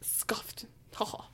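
breathy mocking whisper of the word; whispered scoff. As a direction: hiss it under your breath, teasing. Mock scoff at authority.

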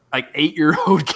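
normal talking voice

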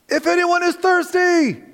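very high, held ringing shout; piercing cry. Acted. Jesus crying out over the ballistic crowd, very high shout. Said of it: "everyone stops as he cries out".

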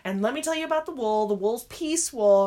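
mimicking an eager older knitter, high and slow in places. Knitter tells a stranger about her sweater, eagerly. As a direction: gush about your old pattern and wool. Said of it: "They're like".

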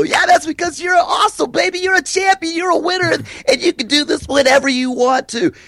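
excited cheering. Retold or imitated. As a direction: pump them up, yelling praise. Cheering a client on.

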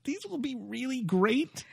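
high, soft and playful; silly high voice. Acted. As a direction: coo it in a high squeak. Playful high voice among hosts.

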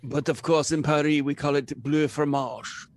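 pompous French waiter; comic French accent. Acted. Waiter explaining what Paris calls it, French accent.

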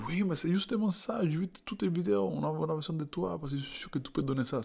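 high, fast, gushing voice; eager casting director. Retold or imitated. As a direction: pitch it up and rush it out keenly.

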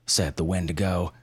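ordinary delivery, nothing marked

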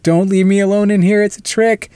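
imitated yelling. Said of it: "Mirta's yelling at him".

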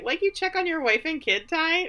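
high-pitched pointed question; sarcastic retort. Retold or imitated. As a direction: ask it high, with mock sweetness.

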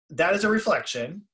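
normal talking voice

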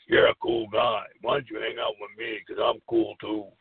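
a hint of a macho bully inviting you to hang out. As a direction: play it like a cocky bully luring you in. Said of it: "In his macho voice".

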